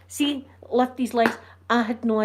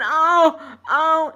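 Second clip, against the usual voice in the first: imitating a man's loud dazed groan; mock loud moaning oh; 6 semitones higher, 9 dB louder than usual. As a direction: groan it out loud, as if half fainted. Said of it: "And he's going".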